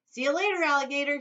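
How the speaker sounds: high, loud cheeky call; boy taunting as he jumps; shouted. Acted. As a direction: fling it back gleefully. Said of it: "he shouted".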